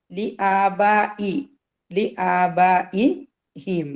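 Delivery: slow, drawn-out chanted recitation; Quran recitation. Shown as a demonstration. Teacher reciting the verse for students to follow. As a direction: recite the verse slowly, melodic. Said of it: "recites slowly".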